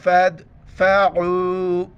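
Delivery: slow drawn آنستم, melodic; Quran recitation. Acted. Teacher recites the word for students, slow tilawat.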